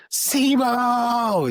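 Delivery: a booming, commanding shout of the name; screamed name. Retold or imitated. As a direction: yell it like Morpheus calling out. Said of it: "It screams it like freaking Morpheus".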